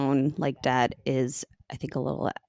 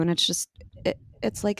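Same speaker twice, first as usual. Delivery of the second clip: ordinary talk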